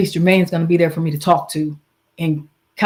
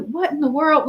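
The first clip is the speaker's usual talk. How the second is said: disbelief; 8 semitones higher than usual. Retold or imitated. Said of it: "I was just like".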